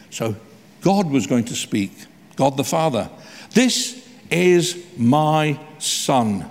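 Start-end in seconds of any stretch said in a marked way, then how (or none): 3.5-6.4 This is my Son drawn out very slowly; slow solemn quote. Acted. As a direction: savour each word of This is my Son. Preacher voices God the Father.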